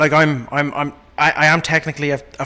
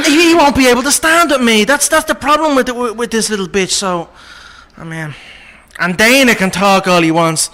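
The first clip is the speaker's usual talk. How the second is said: cocky Irish brogue, trash talk; Irish accent, swaggering fighter's boast; Conor McGregor impression. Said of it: "how long you been doing impressions".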